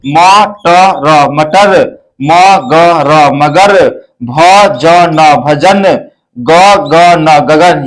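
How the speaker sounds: each letter sounded out slowly, then the whole word; slow spelling-out. Shown as a demonstration. Teacher spelling words for pupils, slow and deliberate. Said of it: "आप देख रहे हैं यहाँ पे".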